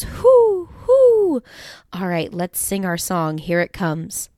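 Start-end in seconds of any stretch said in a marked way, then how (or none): 0.0-1.4 high hooting owl call. Shown as a demonstration. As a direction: hoot it like an owl. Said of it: "An owl goes".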